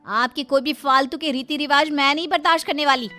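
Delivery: put-on high-pitched, indignant young bride; spirited, protesting daughter-in-law; defiant young wife.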